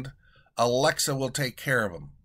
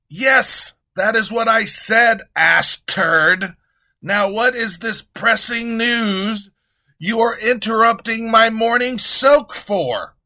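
loud, high haughty king; high, loud king dismissing his treasurer; pompous king; 7 semitones higher, 8 dB louder than usual. Acted. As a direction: brush off the correction loudly.

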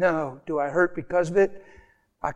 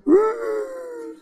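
very high, held crying "ooh"; mock wail. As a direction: burst into a hammed-up high whimper.

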